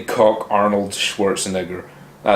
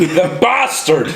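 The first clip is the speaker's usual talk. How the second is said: mock outrage; 6 semitones higher, slower than usual. Mock outrage at Stephen King.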